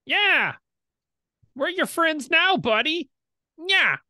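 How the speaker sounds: squeaky high pirate, loud and jeering; shrill little pirate taunting his new buddy. Acted. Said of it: "the pirate goes".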